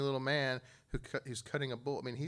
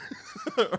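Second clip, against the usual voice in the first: a burst of high laughter; 8 dB louder than usual. Real, not acted. Laughing at his own retelling.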